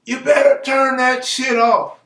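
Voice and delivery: high-pitched yell out a window; mock yell. Bobby yelling out his window at the gardeners, mock yell. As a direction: holler it as Bobby's complaint, pitched well up. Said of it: "Bobby would yell out his window".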